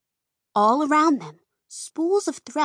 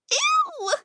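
very high, squealing disgusted shriek. A narrator's reading. Girls shrieking as Dot tastes the glob, very high squeal. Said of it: "Peggy and Rita shrieked".

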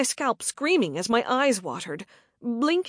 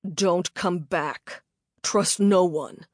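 low menacing warning; 4 semitones lower, slower than usual. A narrator's reading. Attacker's final warning.

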